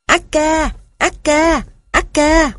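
very high, desperate gosling calls; heartbreaking gosling cries. A narrator's reading. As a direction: wail the name, high and pleading. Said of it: "nghe đứt ruột".

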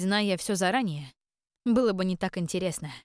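plain reading voice

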